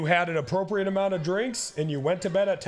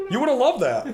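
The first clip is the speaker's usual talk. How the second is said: ordinary talk, nothing marked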